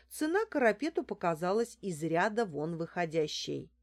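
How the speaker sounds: usual speaking voice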